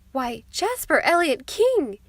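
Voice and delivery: high, loud, drawn-out surprise; astonished exclamation. A narrator's reading. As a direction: call the name out in amazement. Sudden sight of family below.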